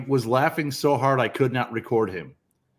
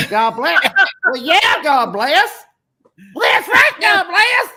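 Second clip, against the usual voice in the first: each God bless pitched higher and louder until it becomes a shout; voice climbing higher; 13 semitones higher, 5 dB louder, slower than usual. Retold or imitated. Brother spams the scam caller with ever higher God bless. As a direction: keep pushing God bless up the scale until you're yelling. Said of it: "he keeps going higher with his voice every time he says".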